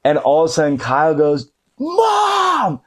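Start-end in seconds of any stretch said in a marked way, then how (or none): 1.8-2.8 high-pitched brother's yell; mock teenage shout of alarm; yelling for mom. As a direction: holler for your mother, voice way up high. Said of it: "And so Kyle's yelling".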